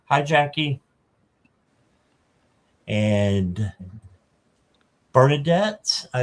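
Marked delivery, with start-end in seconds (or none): none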